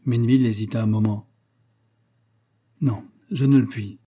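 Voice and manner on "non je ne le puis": halting, reluctant refusal; mildly anguished exclamation; painful effort. A narrator's reading. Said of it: "avec un effort douloureux".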